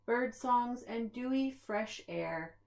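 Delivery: usual speaking voice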